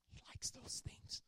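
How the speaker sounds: secret whisper; hushed, slow aside. Real, not acted. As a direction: lean in and whisper it. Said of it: "I'm gonna tell you that quietly".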